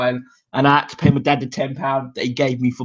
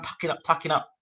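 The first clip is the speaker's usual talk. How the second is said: soft, rhythmic mutter of tucking up; half-whispered, ticking off the rounds; quiet repeated chant; softly repeating; 3 semitones higher, 8 dB quieter than usual. Retold or imitated. Describing boxer covering on ropes.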